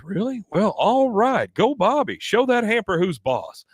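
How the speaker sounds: put-on root for your boy, pitch jumping up; acted fatherly cheering. Recapper plays Hank cheering Bobby on, high and eager.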